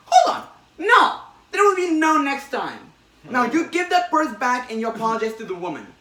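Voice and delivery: shrill scolding. Retold or imitated. Spider-Man ordering the robber to return the purse, shrill and loud. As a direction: scold like an outraged parent, shrill.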